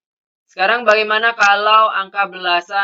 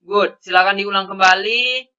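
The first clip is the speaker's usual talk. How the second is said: ordinary delivery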